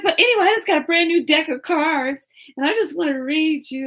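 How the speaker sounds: high, loud sing-song pitch; card reader pressing a stranger, high and loud; pushy reader. Retold or imitated. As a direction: sell the reading brightly. Reader insisting again.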